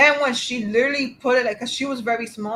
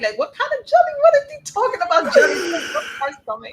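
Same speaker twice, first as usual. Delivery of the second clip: higher, laughing, baffled rant about jelly; laughing disbelief; 8 semitones higher, 4 dB louder than usual. Retold or imitated. Retelling her first ultrasound to a friend, laughing disbelief. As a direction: giggle through the confusion.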